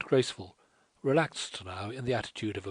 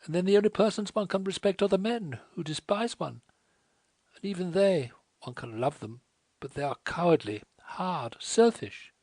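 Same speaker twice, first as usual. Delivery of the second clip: higher, fast, tumbling bitter outpouring; rapidly; 8 semitones higher, 4 dB louder than usual. A narrator's reading. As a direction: pour it all out in a rush. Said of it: "she went on rapidly".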